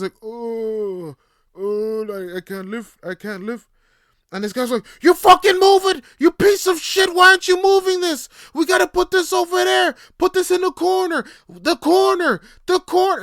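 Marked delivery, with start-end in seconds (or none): 0.2-3.7 put-on high, strained old worker's plea; strained old man. Old worker straining under heavy load, high strained plea. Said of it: "this dude struggling". 5.0-13.2 screamed, much higher and far louder abuse; furious yelling. Retold or imitated. Foreman screaming at old coworker, furious high shout. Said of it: "And it's, like, yelling".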